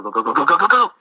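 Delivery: rising to high and loud, urging; urgent hurry. Retold or imitated. Missionary retelling rushing the elders out, rising high and loud. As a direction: hustle your friends out the door.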